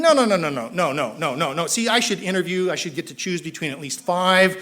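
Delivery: loud, high rapid no no no; frantic refusal. Retold or imitated. Newcomer inwardly refusing the assigned sponsor, loud and frantic. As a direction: pile up objections in alarm.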